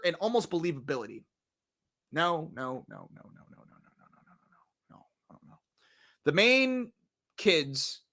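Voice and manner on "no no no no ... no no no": rapid no's dying away to a whisper; fading string of no's. Acted. Reviewer dismissing the story and believability, fading to a whisper. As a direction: let the refusals trail off into near silence. Muttering repeated no's after verdict.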